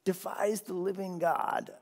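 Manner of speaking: mock-indignant preacher voice. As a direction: build the outrage, then drop it to a hushed punchline. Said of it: "what did he say?".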